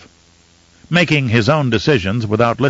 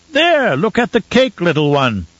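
put-on very high baby talk, dropping at the end; high-pitched, doting exclamation; childish voice; 7 semitones higher than usual. A narrator's reading. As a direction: coo excitedly at a toddler. Count baby-talks to toddler again.

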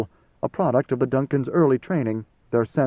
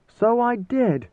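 much higher, slow, otherworldly voice; Muad'Dib; 10 semitones higher, much slower than usual. A narrator's reading. Ruler lets dead father answer old retainer, drawn out. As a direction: draw it out high, as another man speaking through you. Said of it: "conveyed by tone and accent, it was Muad'Dib speaking".

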